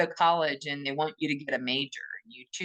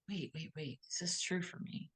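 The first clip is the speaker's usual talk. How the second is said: soft, much quieter, almost whispered; real gentle; 14 dB quieter than usual. Shown as a demonstration. Questioning yourself tenderly about a belief: soft whisper. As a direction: hush it, as if checking with yourself. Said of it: "It's a real gentle".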